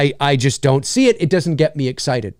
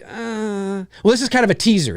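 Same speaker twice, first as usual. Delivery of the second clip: meh, not sold on it; unimpressed reaction; 4 semitones higher than usual. Acted. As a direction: let out a long, underwhelmed noise. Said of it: "I'm just like".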